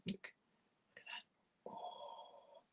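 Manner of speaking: hushed, breathy awe at the syrup; awed whisper. Real, not acted. Showing viewers the syrup spoon, in a hushed awed whisper. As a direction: whisper it like something precious.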